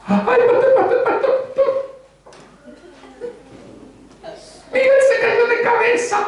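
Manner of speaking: very high, frantic falsetto; hammed-up woman crying out that Miguel fell; panicked church lady. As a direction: squeal like a flustered woman running to the pastor. Church woman reporting a fall.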